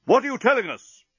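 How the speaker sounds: high, far voice for the captain; a high-pitched captain demanding answers; captain's demand. Acted. Captain pressing the crew, high and insistent.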